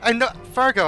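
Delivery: normal talking voice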